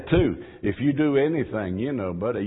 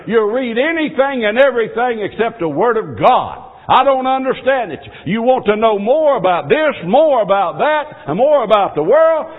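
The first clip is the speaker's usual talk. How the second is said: loud, raised preacher's cry; full-force revival preaching; fiery preaching; 8 semitones higher, 5 dB louder than usual. Acted. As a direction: drive the sermon home with fervor.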